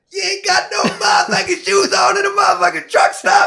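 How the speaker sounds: put-on high yelling American accent; shouting American accent. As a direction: shout it like a stunned trucker. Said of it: "And he's like".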